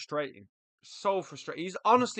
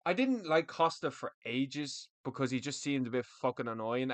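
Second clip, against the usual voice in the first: ordinary talk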